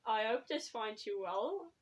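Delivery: ordinary talk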